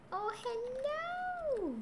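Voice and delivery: very high-pitched, long drawn-out hello; squeaky sing-out to a pet; drawn-out greeting. Real, not acted.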